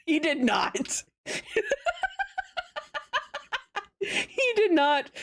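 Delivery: helpless laughter between the words; laughing. Real, not acted. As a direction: break up laughing as you tell it. Cracking up at own story.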